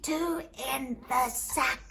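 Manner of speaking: slow, laboured gasp of a command; barely breathing. Acted. Beaten man ordering cudgels away, barely breathing. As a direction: squeeze the words out with no breath left. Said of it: "All I could do was barely breathe".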